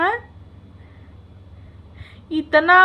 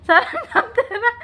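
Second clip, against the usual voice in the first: high, laughing jeer; words broken up by giggles; teasing laughter; 6 semitones higher than usual. Real, not acted. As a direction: laugh while you taunt. Laughing while teasing a rival.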